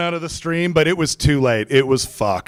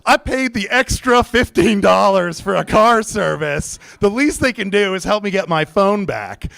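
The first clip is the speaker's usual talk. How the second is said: pissed off; 5 semitones higher than usual. Retold or imitated. Customer griping about the car service, pissed off. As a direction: air a grievance, pitched up. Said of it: "I'm starting to get pissed off".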